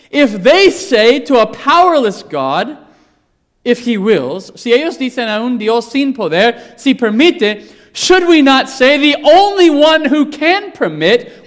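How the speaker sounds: loud, high, emphatic preaching; preaching at full force. Acted. Preacher pressing the church, loud and rising. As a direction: drive it home to the congregation.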